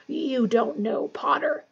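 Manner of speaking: ordinary delivery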